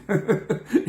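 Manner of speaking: short self-amused laugh; chuckle. Real, not acted. Teller laughing about returning as a waiter, amused chuckle. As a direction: chuckle at your own story. Laughs at his own story.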